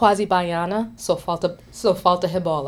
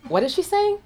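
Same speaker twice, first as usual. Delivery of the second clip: puzzled villager voice; 12 semitones higher than usual. Retold or imitated. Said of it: "turns to my language facilitator and goes".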